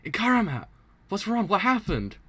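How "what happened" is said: usual speaking voice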